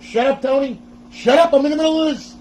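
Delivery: high, loud imagined outburst; mock exasperated yelling. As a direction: playfully yell at someone to back off. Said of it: "you're probably sounding right now, going".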